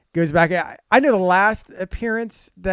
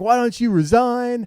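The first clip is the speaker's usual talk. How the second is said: higher, drawn-out mock heckle; imitated heckler yell; 6 semitones higher, slower than usual. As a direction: imitate fans calling down at a coach. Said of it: "they were yelling at him".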